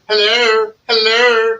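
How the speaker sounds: high, loud showman hello; greeting voice. Pretend. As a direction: belt out a welcoming hello. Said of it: "I was practicing on the way".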